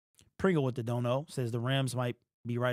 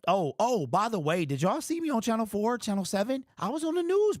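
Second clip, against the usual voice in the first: suddenly giddy and high, bragging; bursting with excitement over the news; excited; 7 semitones higher than usual. Real, not acted. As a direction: voice jumps way up, thrilled to be on TV. Sudden excited brag about TV.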